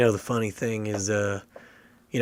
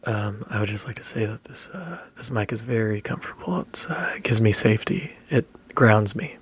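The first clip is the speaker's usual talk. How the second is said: hushed, near-whispered NPR host. Shown as a demonstration. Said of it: "give me your best N P R voice".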